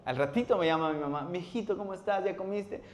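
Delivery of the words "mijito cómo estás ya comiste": higher, gentle motherly voice; caring mother. Retold or imitated. Mother phoning her hungry son, lightly voiced gentle mom. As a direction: sound like a mom checking on her boy.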